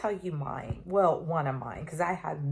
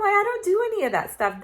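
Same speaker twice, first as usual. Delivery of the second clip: higher and louder, shocked refusal; alarmed refusal; 13 semitones higher, 5 dB louder than usual. Retold or imitated. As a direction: blurt it out, high and loud in alarm. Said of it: "I'm like".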